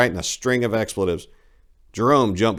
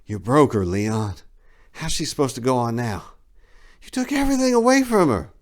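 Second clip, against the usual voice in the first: protesting Rio; 5 semitones higher than usual. A narrator's reading. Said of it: "Rio protested".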